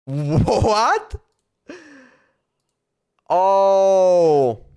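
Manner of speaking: normal talking voice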